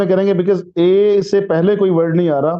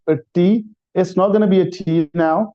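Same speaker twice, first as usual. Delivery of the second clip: ordinary talk, nothing marked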